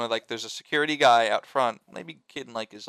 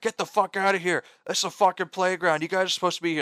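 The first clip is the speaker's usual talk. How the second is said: mock angry yell; 6 semitones higher than usual. Angry playground dad ordering teens out, mild mock yell. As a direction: imitate the angry dad chasing them off. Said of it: "he starts screaming us out".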